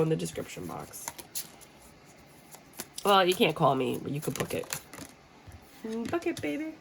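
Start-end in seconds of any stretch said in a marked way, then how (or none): 5.9-6.7 high, soft teasing purr; soft flirty tease. Acted. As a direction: coax them to book, playfully. Flirty sign-off to viewers.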